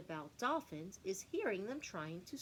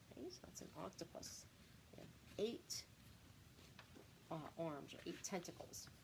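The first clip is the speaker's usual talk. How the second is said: hushed aside; 2 semitones lower, 9 dB quieter than usual. Real, not acted. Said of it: "teacher drops to aside".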